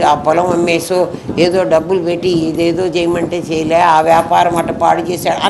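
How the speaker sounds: low, mourning relatives griping about the dead man; lightly voiced complaining relatives, pitched low; grumbling mourners. Mourners at a funeral griping about the dead man, low, grumbling. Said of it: "ఏమంటారంటే వాళ్ళందరూ కలిసి".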